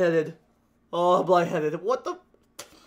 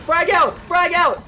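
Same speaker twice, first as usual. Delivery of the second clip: loud, high callout; calling out; 7 semitones higher, 6 dB louder, slower than usual. Real, not acted. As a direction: shout the warning to the team. Throwing grenade, calling out.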